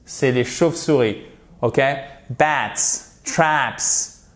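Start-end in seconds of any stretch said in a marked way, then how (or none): 2.3-4.2 BATS, TRAPS separately with final hissed s; isolated words, falling, pause between; repeat-after-me model. Shown as a demonstration. Teacher invites students to repeat, model pronunciation. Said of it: "Allez-y".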